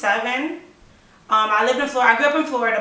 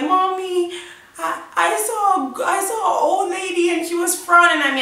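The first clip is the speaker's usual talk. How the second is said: imitated crying child; 8 semitones higher than usual. Scared child telling mommy about the old lady, imitated tearful voice. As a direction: mimic a tearful child, voice pitched well up. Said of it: "she saw me crying".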